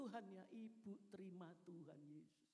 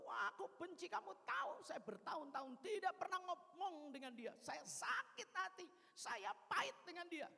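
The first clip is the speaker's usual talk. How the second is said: raised, higher voice full of resentment; a sister spitting out years of hurt, louder and higher; hard bitter retort; 8 semitones higher, 5 dB louder than usual. Retold or imitated. Sister refusing to pray for her mother-in-law, resentful and raised. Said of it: "Adik saya keras".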